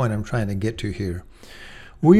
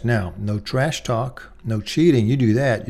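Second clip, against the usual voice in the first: usual speaking voice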